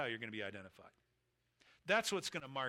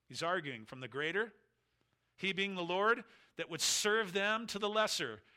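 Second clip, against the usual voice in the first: ordinary talk, nothing marked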